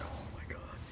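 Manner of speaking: breathless mortified whisper. Retold or imitated. Mortified boy as the teacher shows his drawing, whispered. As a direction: breathe it out, no voice at all.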